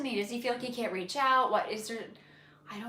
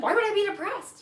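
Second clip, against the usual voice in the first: mock baffled husband; 8 semitones higher than usual. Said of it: "he's like".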